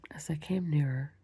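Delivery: plain reading voice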